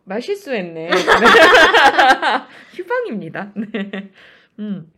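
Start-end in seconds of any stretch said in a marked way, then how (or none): 1.0-2.7 high, loud embarrassed laugh; loud burst of laughter. Real, not acted. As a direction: crack up at the mistake. Laughs at own slip. (크게 터지는 웃음)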